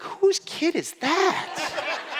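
high, slow, laughing in amazement; half-laugh, stunned by her maturity; laughing disbelief. Retold or imitated. Parents amazed at daughter.

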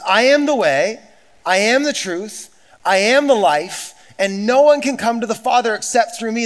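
high, loud, slowing proclamation; preaching at full force. Acted. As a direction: declare it to the whole room. Builds loud repeated declaration.

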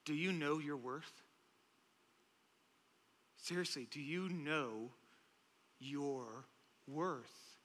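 soft, low and searching; quiet earnest appeal. Real, not acted. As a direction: ask it gently, with long weighty pauses.

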